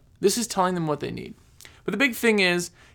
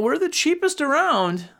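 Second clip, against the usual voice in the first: high, drawn-out cheerful salesman voice; mock sales boast; 9 semitones higher than usual. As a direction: pitch it up like an eager, clueless salesman. Acts out a boastful contractor's pitch.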